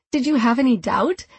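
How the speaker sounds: teasing. A narrator's reading. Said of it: "I teased".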